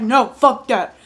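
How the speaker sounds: loud, forceful mock refusal; rude customer imitation. Rude customer refusing cashier's receipt, loud mocking shout.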